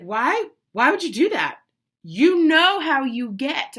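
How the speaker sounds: loud, rising reproach; exasperated husband. Retold or imitated. Husband asking why she read it, imitated exasperation. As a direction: imitate a partner scolding in disbelief. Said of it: "He was like".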